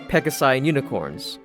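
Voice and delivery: ordinary delivery, nothing marked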